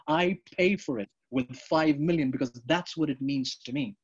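normal talking voice

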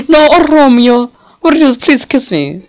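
high, loud hammed-up lovesick voice; exaggerated pleading lover; mock swooning lover. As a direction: beg your Romeo like a melodrama heroine. Voicing romantic game character.

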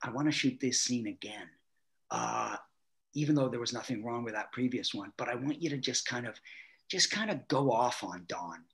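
low, hushed, conspiratorial aside; imitated whisper. Director secretly setting up a prank, hushed imitation. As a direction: confide it softly, voice kept down. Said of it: "he whispers in my ear".

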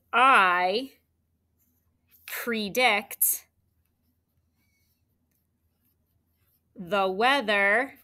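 slow with a pause after each word, predict whispered; reading the sentence aloud for students to write; slow dictation. Shown as a demonstration. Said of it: "let's go ahead and try the sentence".

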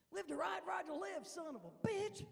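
a hammed-up tough biker growl; biker stepdad. Stepdad boasting his biker motto, gruff.

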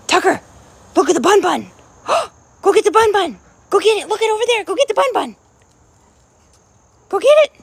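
high, loud eager urging; excited dog talk. Real, not acted. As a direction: hype the dog up to chase. Urging dog after bunny.

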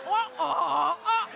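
loud, hammed-up "oh" moans; mock orgasm moans. Comedian imitating the pig's thirty-minute climax, mock moaning. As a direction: moan it out in loud comic bursts.

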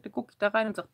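normal talking voice

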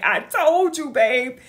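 high, loud told-you-so; excited. Retold or imitated. Telling her partner she was right, excited and loud. Gloating at partner, voiced loudly.

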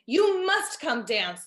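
slow, loud angry command; crying out. Acted. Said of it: "the monarch cried".

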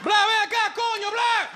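very high, loud hammed-up dad bellow in Spanish; explosive, garbled Spanish shout imitating dad; dad's garbled yell. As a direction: yell it like a noise, not a name. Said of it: "my dad just makes a noise".

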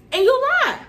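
shrieked high and loud in outrage; outraged high yell. Real, not acted. To the girl who lied, a high outraged shriek. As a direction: throw the accusation up high.

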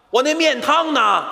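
拖长声音、抬高调门追问; 假装生气地高声催问. Acted. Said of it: "我生气了". (等急了，生气地催服务员上面汤)

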